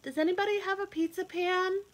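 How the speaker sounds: high, fast call to the neighbours. Acted. Hen calling out the window to the animals, high and quick.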